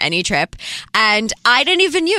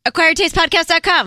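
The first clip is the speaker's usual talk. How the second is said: eager quiz answer; 8 semitones higher than usual. Acted. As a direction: call it out like the class keener.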